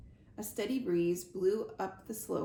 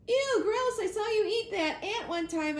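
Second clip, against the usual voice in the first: high, loud squeal of disgust; excitable squirrel recoiling in disgust; grossed out; 11 semitones higher, 6 dB louder than usual. A narrator's reading. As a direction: pitch it way up and push it out.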